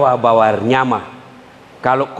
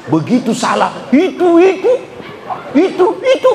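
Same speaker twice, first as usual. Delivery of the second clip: nagging wife. Pretend. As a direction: nag him over and over. (istri mengomel berulang-ulang)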